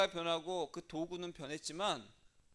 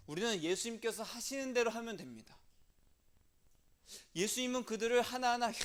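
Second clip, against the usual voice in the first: preaching loud; 6 semitones higher, 7 dB louder than usual. Real, not acted.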